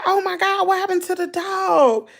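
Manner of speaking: high-pitched mock dismay; retold shocked concern. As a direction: exclaim it high, replaying your alarm over the dog. Retells her concerned reaction.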